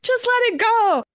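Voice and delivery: high, sing-song mocking healer voice; mock spiritual advice. Mocking what healing culture tells people, high sing-song. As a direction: parody a breezy manifestation guru. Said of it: "Or when people tell you".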